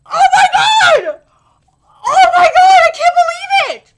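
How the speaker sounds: high, loud fangirl scream; excited screaming. Real, not acted. Fan reacting to a scene from later in the story, ecstatic screaming. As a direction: scream it in real disbelief and delight.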